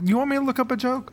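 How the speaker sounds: high, fast disbelieving echo; high incredulous repeat. Real, not acted. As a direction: throw it back in disbelief.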